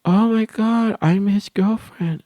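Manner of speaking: put-on high, slow gushing girl; mock girly gush. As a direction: announce it like a proud little girl. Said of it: "she's over here".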